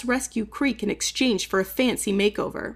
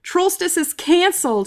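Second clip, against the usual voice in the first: declared in a fit; 6 semitones higher than usual. Acted. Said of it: "he declared in a fit".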